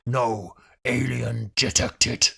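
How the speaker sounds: low, slow alien scanner voice; imitated alien troopers. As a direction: intone it like an alien officer. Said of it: "they were like".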